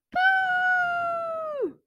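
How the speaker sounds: high, far-off boo; distant echo. Acted. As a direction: call from far away. Boo echoing down from sky.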